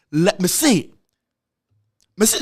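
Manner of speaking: usual speaking voice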